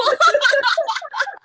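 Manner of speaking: high laughing burst; real laugh. Real, not acted. Laughing about her mom's hard life, a real laugh.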